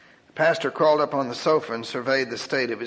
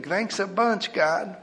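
sarcastic thanks; 5 semitones higher, slower than usual. Acted. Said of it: "He was thinking".

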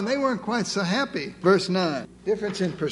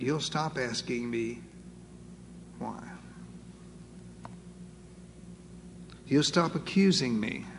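tender voice of God; 7 semitones lower, 8 dB quieter than usual. Acted. God's voice speaking gently to Moses.

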